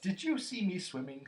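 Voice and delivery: put-on high, loud little-child voice; thrilled piping question; excited squeak. A narrator's reading. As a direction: squeak it out eagerly. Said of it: "squeaked Roo excitedly".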